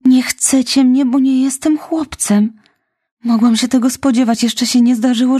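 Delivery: put-on high, tearful girl crying out she is unwanted; a little orphan near tears, voice pitched high; distraught girl. Orphan girl to Marilla, high and near tears. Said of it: "krzyknęła".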